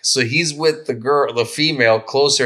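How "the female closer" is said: ordinary talk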